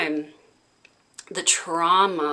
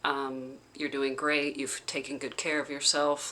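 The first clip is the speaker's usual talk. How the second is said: a hint of the facilitator, softer and gentle; soft soothing facilitator; 3 semitones lower, 9 dB quieter than usual. Facilitator comforting her during the session, softly soothing. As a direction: hush down to a soothing reassurance. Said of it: "she was like".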